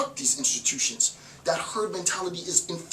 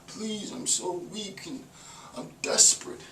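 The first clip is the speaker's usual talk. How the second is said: soft, higher, pathetic pleading voice; mock beggar pleading; 4 semitones higher, 6 dB quieter, slower than usual. Lecturer mocking someone begging the institution, soft and higher. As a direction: whimper like a weak beggar. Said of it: "asking for alms".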